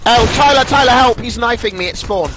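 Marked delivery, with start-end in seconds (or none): 0.0-1.2 calling for help. Real, not acted. Calling teammate for help in game.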